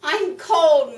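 loud, high complaint as a cow; lightly voiced mooing cow, loudly protesting the cold. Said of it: "mooed the cow".

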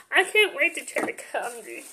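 normal talking voice

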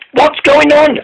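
high, anxious little engine voice; young engine Mike, alarmed. A narrator's reading. Mike to Boko, high and alarmed, pleading to slow. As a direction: Mike begs his friend to ease off.

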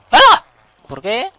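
a high loud yelp of shock, then protest; startled yelp. Real, not acted. Gamer startled by a glitch, yelping in surprise. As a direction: caught off guard, cry out high and loud.

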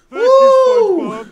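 long sigh of relief. Real, not acted. Relief after the win.

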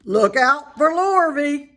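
loud, slow cow warning; slow loud cow call. A narrator's reading. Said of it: "called the cows".

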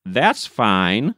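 usual speaking voice

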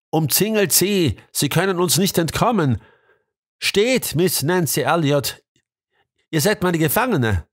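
high, ringing orders to the men; commanding call. A narrator's reading. Police prefect arresting Nancy Elliot, high commanding call. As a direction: bark the arrest like a police chief. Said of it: "eine befehlende Stimme rief".

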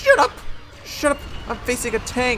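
high, loud outburst; frantic, irritated shouting; exasperated shout. Real, not acted. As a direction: yell it back, pitch leaping up. Yelling back while facing a tank.

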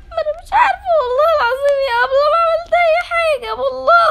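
high, sobbing plea of innocence; crying denial. Acted. Accused schoolgirl sobbing to her teacher. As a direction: beg through tears that you did nothing.

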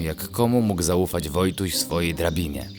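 plain reading voice

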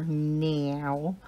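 "now" mimicked as "nee-ow"; extra syllable. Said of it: "like you're putting another syllable in there".

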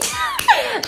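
sudden high laugh at the memory; burst of laughter. Real, not acted. Telling the family story, she breaks into a high laugh. Mother's joke on good news.

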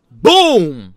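a sudden loud punchy boom. Retold or imitated. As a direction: slam the sound out like an impact. Sound of punch in story.

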